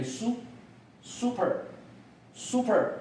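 American "super", starting with a plain "soo"; American model of "super" for learners; American pronunciation. Shown as a demonstration. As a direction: say "super" slowly American-style, "SOO-per". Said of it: "美国发音是念".

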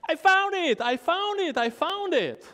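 put-on high, loud, gleeful cry of a boy who found it; excited boy shouting his find; very happy. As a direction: burst out with proud joy. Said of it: "Very happy".

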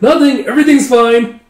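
high, loud, tearful bride imitation; upset denial. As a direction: protest high and loud through pretend tears. Crying bride denies anything is wrong.

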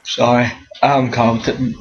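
talking through a yawn; words stretched by a yawn. Real, not acted. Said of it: "I'm yawning".

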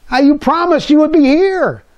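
put-on high, whiny little boy voice; boy sulking in his room, blaming his mother; whining child. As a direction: whine the accusation like a hurt kid.

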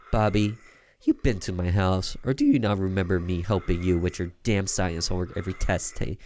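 usual speaking voice

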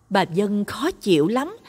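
usual speaking voice

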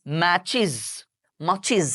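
ম্যাচিজ with an extra iz syllable; ম্যাচিজ, then মাচিজ, ending in iz; match-iz, two clean syllables; say matches. Shown as a demonstration. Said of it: "ইজ".

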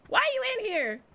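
high-pitched, startled question; startled indignation. Real, not acted. Gamer finding someone in her room, startled indignation. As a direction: call out the intruder in surprise.

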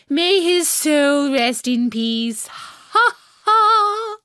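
gloating queen. A narrator's reading. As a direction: savour the cruelty like a smug queen.

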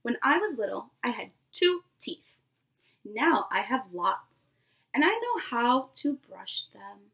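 lively picture-book read-aloud to a class; storybook voice for a nursery class; reading to children. Acted. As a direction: read it like story time for little kids. Teacher reads picture book aloud.

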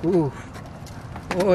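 ordinary talk

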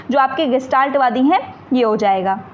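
usual speaking voice

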